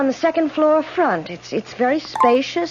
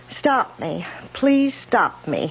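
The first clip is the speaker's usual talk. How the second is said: ominous slow reading; 2 semitones lower, 3 dB quieter, much slower than usual. Acted. Reading ominous lipstick message aloud.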